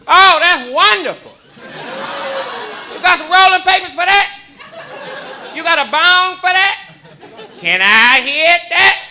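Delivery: high, loud, slow eager granny; hammed-up grandma begging for a hit. Grandma asking her grandson to share the weed.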